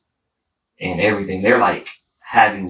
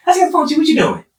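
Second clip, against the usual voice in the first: high teasing mom voice, much higher; mimicking his mom; 5 dB louder, much faster than usual. His mom on speaker, teasing him in a high voice. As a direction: play mom joking in a high voice. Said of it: "She making a joke".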